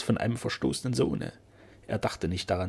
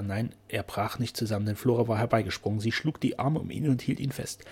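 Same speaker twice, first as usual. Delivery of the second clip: usual speaking voice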